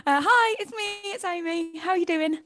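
high mock-shy introduction; silly voice. Shown as a demonstration. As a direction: squeak out the hello in a silly voice. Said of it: "pressure now to do an accent".

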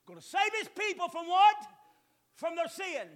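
loud, rising sermon cries; preaching at full force. Real, not acted. Pastor crying out to the congregation, loud and fervent. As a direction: drive the point home to the church.

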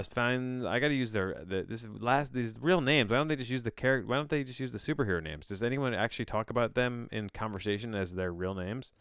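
normal talking voice

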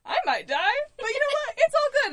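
mock-carefree shout with laughter; hammed-up bravado. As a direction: play it cool about maybe dying, over the top. Hammed-up joke about dying on hike.

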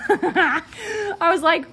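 laughing through the words, high and loud; can barely get it out for laughing. Real, not acted. Narrator cracking up at the boy's name, laughing.

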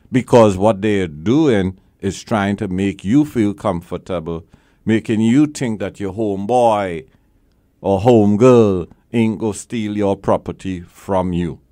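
ordinary delivery

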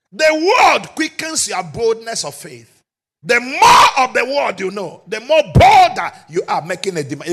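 loud, ringing sermon delivery; rising pulpit shout; full-force preaching. Acted. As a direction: drive it home to the congregation. Preaching climax.

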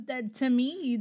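ordinary delivery

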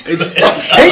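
sheepish laugh at having no answer; embarrassed laugh. Real, not acted.

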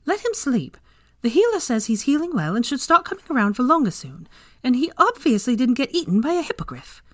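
high-pitched, insistent scolding; exasperated, rising reproof; irritated. A narrator's reading. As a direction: tell a friend off, pitched well up.

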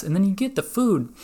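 ordinary delivery

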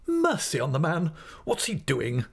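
high, impatient exclamation. A narrator's reading. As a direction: cry out as an exasperated aunt. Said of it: "cried my aunt impatiently".

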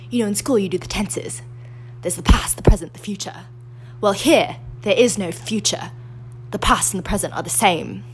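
breathy British princess impression; hushed, put-on British royal voice; Kristen Stewart as Diana. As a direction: do a whispery, posh English mother. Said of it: "when Kristen Stewart is talking to her sons".

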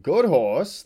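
loud, high, winded call; panted. A narrator's reading. Fat man coaxing after the chase, panting. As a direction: puff it out loudly. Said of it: "the fat man panted".

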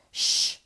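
a sharp loud shush; loud group shush. Acted. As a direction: shush her hard.

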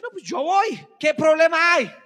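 loud, cocky and high; hammed-up bragging believer; mock boastful shout. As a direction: brag it loudly like a know-it-all. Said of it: "Entonces dice".

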